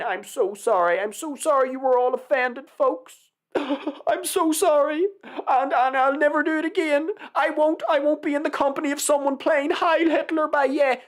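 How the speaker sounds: high, pleading fake apology; mock groveling. Mimicking an apology he says never happened, mock groveling. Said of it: "He didn't say it in a groveling way".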